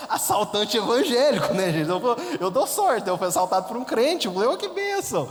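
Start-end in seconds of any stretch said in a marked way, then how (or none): 0.1-4.3 can't believe the absurd luck; laughing. Real, not acted. Ri do absurdo da situação. 4.5-5.3 very high, delighted church exclamation; hammed-up joy at the blessing; mock rejoicing. Celebra o assaltante crente.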